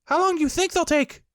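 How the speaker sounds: very high, loud little kangaroo cry; high squeaky baby kangaroo; excited Roo cry. A narrator's reading. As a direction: squeal it out like a tiny excited joey. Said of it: "asked Roo".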